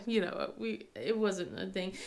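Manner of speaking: ordinary delivery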